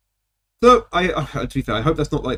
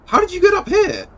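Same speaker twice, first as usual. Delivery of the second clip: amazed. Real, not acted. Delighted at the friend.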